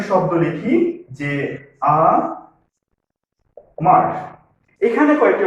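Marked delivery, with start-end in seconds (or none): 1.8-4.1 আমার slowly, with a long pause after আ; teacher sounding out আমার in two separate syllables; split into syllables. Shown as a demonstration. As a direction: break আমার into আ, then মার. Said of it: "অক্ষর আছে".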